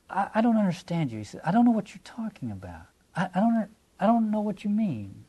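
gently dodging with puzzled innocence; mild evasive denial. Acted. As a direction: play innocent, sidestep the accusation. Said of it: "but see how mild that is".

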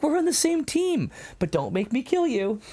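high, giddy imitation; excited. Host acts out Quintus telling Jesus they're one team, excited. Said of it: "Quintus is just so excited".

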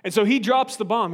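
ordinary talk, nothing marked

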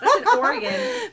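laughing out the words; giggling through a correction. Real, not acted. Laughing at her own slip.

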